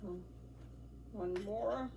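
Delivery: ordinary talk, nothing marked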